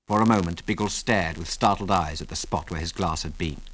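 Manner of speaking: plain reading voice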